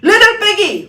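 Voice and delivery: high, loud menacing command; really angry wolf. Acted. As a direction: order the piggy to open, furious. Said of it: "really angry now".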